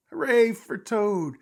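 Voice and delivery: high, very loud hooray; shouted cheer. A narrator's reading. Frog cheers Toad on stage, shouting. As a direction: cheer from the audience at full voice. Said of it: "he shouted".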